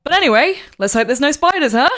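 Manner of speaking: loud, high, chirpy change of subject; sudden high-pitched perky sign-off; forced cheer. Acted. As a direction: brush it off brightly and loudly. Character abruptly brightens after solemn farewell.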